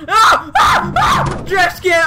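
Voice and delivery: loud panicked cry; panicked shout. Real, not acted. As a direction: yell out in alarm. Caught in game.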